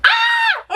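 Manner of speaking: high sudden shriek of fright; startled scream. Real, not acted. Startled by the screen, a high shriek. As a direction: yelp at the jump scare.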